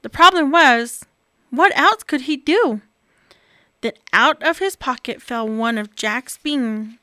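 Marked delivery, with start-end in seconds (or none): none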